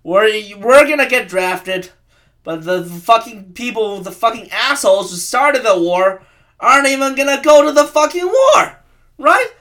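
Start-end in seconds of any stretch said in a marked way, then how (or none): none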